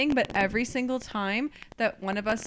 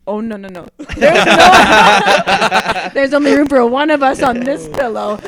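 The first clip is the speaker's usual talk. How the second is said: laughing refusal; 12 dB louder, slower than usual. Retold or imitated. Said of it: "He goes".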